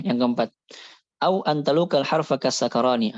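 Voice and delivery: ordinary talk, nothing marked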